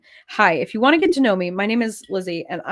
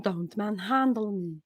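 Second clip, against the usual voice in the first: sassy dog, slow and softer; a slow, soft diva-pet complaint; Pepper; 7 dB quieter than usual. Shown as a demonstration. Sassy dog complaining about being handled, slow diva voice. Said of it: "she's really sassy".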